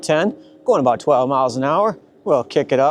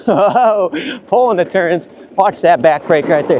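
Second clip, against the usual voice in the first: usual speaking voice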